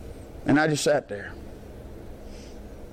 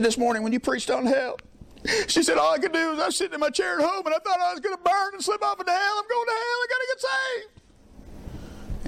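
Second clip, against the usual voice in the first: high mock wail, climbing higher to the end; hammed-up bawling, stretching the last words into a screech; imitating hysterical woman; 12 semitones higher than usual. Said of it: "crying, bawling, screaming".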